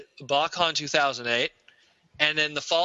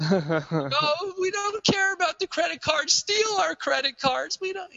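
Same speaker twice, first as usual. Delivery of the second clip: much higher, dazed fan gushing; mock starstruck fan; 10 semitones higher, slower than usual. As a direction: gush like a fan who forgot everything. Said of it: "everyone just totally forgot".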